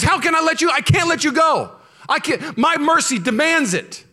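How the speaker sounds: high, loud, anguished pleading; God pleading aloud. Acted. Preacher voicing God's torn heart, loud and pleading.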